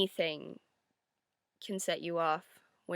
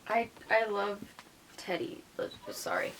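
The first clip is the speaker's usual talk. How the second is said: usual speaking voice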